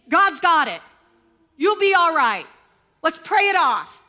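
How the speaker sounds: mock chipper church advice that skips the pain; glib reassurance. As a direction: toss it off like a cheerful church friend. Glib churchgoer dismissing grief.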